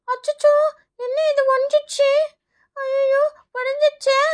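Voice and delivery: put-on high, drawn-out childlike dismay; dismayed child. Child finds the toy tree has broken, dismayed. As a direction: wail lightly at the mishap, slowing at the end.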